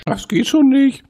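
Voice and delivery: high mocking voice. Real, not acted. Teasing the struggle.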